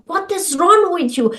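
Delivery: a hammed-up scold; mock self-scolding. Woman mimicking her old angry reaction, mock scolding. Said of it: "I used to be so pissed and angry with myself".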